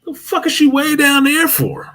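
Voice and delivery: high indignant what-the-fuck; incredulous high voice. Retold or imitated. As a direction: jump way up in pitch, baffled. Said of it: "I'm like".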